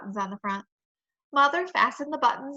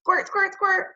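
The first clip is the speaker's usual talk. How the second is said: higher, louder squirt noise; playful squirt sound; 7 semitones higher, 4 dB louder, slower than usual. A narrator's reading. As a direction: play at spraying seltzer. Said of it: "I said again".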